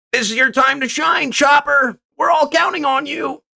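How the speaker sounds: squeaky shouting crewmate; high cartoon crew voice. Voicing crewmates cheering Chopper.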